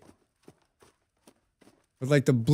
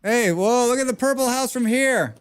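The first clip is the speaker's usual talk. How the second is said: high, louder surprised call; surprised whoa; 10 semitones higher, 4 dB louder than usual. Real, not acted. Gamer spots the purple house, surprised and high. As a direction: caught off guard by the purple house view.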